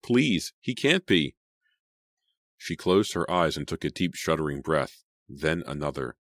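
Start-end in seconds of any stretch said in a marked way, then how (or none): 0.0-1.4 rising, ever higher pleading; panicked pleading. A narrator's reading. Sydney begging in her head that Jason lives, panicked. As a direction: beg it with climbing panic. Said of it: "she thought in a panic".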